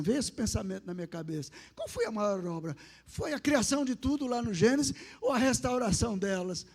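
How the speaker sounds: usual speaking voice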